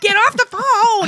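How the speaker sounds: very high, loud mock yell; hammed-up demanding kid; daughter yelling. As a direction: holler impatiently across the house.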